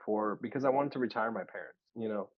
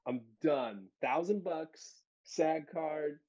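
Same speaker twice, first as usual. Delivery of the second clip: higher, giddy declaration; mock triumph; 8 semitones higher than usual. Young actor celebrating his union card, giddy. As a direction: crow about making it, pitched up.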